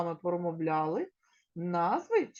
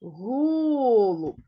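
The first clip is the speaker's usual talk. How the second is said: Голуб with ГО loud and long; stress on first syllable; 8 dB louder than usual. Shown as a demonstration. Teacher shows children the stressed syllable, loud and clear. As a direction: punch ГО in Голуб. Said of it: "На який склад падає наголос".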